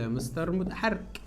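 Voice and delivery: soft, lightly voiced pupil reply; student answering. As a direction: play a pupil quietly answering the teacher. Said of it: "هتقول لي".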